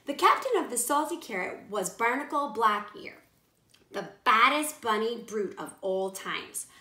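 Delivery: plain reading voice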